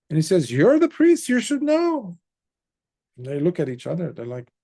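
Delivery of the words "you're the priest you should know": high, loud rebuke; exasperated king. Acted. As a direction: throw the blame back at the priest. King rebukes his priests.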